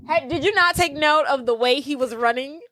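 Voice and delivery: ordinary talk, nothing marked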